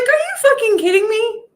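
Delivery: high, loud incredulous cry; mock outrage. Told to invite dark energy closer, loud high incredulous outrage.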